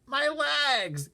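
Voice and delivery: high, loud cry of distress. Acted. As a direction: howl about his legs. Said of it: "he cried".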